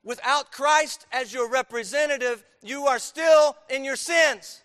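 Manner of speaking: loud, slow preacher's cry; preaching at full force. Acted. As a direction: drive it home to the congregation. Preacher raising voice.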